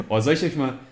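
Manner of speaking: high breathy giggles; can't hold back the giggle; giggling. Real, not acted. Giggling before playing clip.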